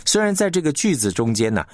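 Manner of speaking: ordinary delivery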